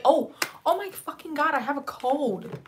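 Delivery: startled exclamation; sudden realization. Real, not acted. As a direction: blurt it as it hits you. Suddenly remembers the code.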